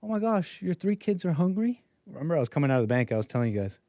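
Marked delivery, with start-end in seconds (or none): 0.0-1.8 high, soft mock concern; mock sympathy. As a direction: fake sympathy sung up high. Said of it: "I'm like".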